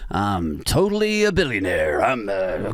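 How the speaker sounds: low-pitched American pick-up voice; American accent. Acted. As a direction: put on a low American accent, laughing partway. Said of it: "I need an American accent for that".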